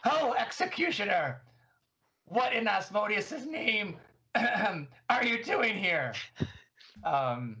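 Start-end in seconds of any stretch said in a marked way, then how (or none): 0.1-6.1 high, slow, theatrical menace; menacing challenge. Pretend. Player character confronts the devil executioner, high and drawn-out. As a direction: loom over him, drawing out every word. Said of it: "as menacingly as I can".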